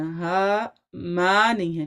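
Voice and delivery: ما drawn out long and slow as the wrong reading; stretched wrong vowel demo. Shown as a demonstration. Teacher showing students the wrong long ما, slowly drawn out. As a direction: demonstrate ما with an overlong fatha. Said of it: "فتح کو لمبا نہیں کرنا".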